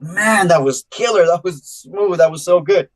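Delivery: smug pride. Retold or imitated. Rookie rep bragging to himself after a pitch to a renter. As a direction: savour it like you just nailed the pitch. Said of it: "I was like".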